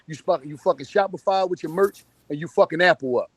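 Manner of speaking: ordinary delivery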